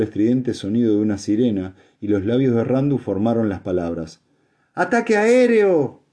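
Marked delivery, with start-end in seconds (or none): 4.7-6.0 high, loud, drawn-out warning cry; slow, soaring cry of danger; alarmed shout. A narrator's reading. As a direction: shout the alarm across a hall. Alarm cried as siren sounds.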